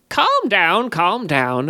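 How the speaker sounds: high, raspy soothing sprite. A narrator's reading. The sprite calms the guard, high and raspy. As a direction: rasp it out like a sly little fey. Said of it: "rasped the little rapscallion".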